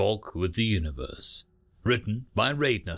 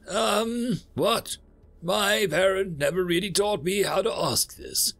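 higher, hesitant character voice; awkward universe; 7 semitones higher than usual. Acted. Universe working up to an embarrassing request, awkward. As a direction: stall awkwardly, pitched up, with ums.

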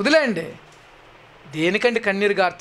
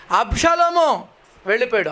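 usual speaking voice

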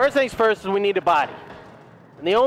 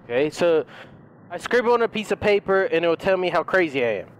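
normal talking voice

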